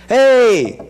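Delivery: high loud hail; calling out. Shown as a demonstration. Visitor calling to the house, loud hail. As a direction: shout it as if outside a house. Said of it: "Como é que a gente diz?".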